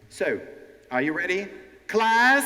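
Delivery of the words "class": "Class?" high, loud and rising; calling the class. Shown as a demonstration. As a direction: sing out "Class?" as a rising call. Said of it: "that was wrong intonation. I say, "Class?".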